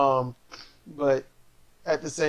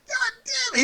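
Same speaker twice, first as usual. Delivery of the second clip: high squeaky mock curse; mock angry cry. Imitating angry Juice cursing, high mock outburst. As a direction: squeal it like a sore loser.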